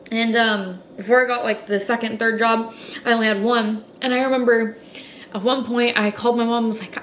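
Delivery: usual speaking voice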